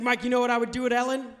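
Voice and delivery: a dad itching to share his idea; eager dad. Retold or imitated. Dad pressing his son, fast and eager.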